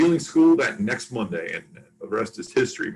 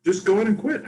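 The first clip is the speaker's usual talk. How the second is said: sister voiced higher, firm and urging; insistent sister; 12 semitones higher than usual. Older sister urging brother to quit, higher firm voice. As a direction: push him to quit, lightly voiced. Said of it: "She goes".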